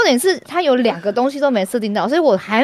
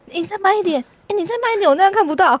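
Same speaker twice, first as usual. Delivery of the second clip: exasperated pleading; 7 semitones higher, faster than usual. Retold or imitated. Retelling pleading coworker to slow down.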